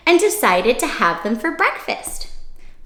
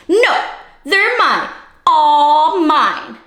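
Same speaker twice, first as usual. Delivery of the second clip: much higher, louder greedy bug yell; grouchy scream; 5 semitones higher, 5 dB louder, much slower than usual. Acted. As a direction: shriek it like a spoiled little bug. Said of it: "screamed the grouchy ladybug".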